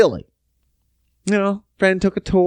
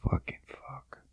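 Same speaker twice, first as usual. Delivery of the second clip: whispered, much softer curse under breath; whispered frustrated swearing at sketchy wiring; 3 semitones lower, 20 dB quieter than usual. Real, not acted. Frustrated muttering while working.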